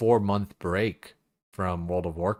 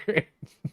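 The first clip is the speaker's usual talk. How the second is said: short laugh; 9 semitones higher than usual. Real, not acted. Laughing at his own confusion.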